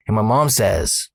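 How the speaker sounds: ordinary talk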